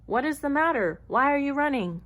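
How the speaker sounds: commanding lion, voice lifted; roaring lion demand. A narrator's reading. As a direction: call out over a panicked crowd. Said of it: "roared the lion".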